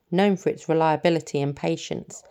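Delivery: ordinary delivery, nothing marked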